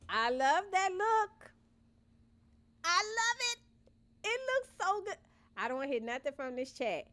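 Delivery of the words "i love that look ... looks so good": high, excited gushing; thrilled high praise; delighted squeal. Real, not acted. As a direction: gush over the build, pitch way up. Delighted by her build.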